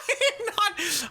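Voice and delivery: laughing through the words. Real, not acted. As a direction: say it while still laughing. Laughing at own joke.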